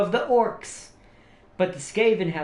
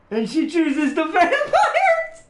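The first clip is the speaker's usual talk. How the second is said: laughing excited exclamation; 10 semitones higher, 5 dB louder, slower than usual. Real, not acted. Viewer picks vampire faction.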